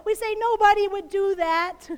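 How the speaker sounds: normal talking voice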